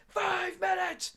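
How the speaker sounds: high, slow strangled cry of disbelief; squeaky outburst. Acted. About the wasted phone call, a high strangled squeal. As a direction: squeal it in despair.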